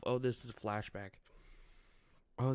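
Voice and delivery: ordinary talk, nothing marked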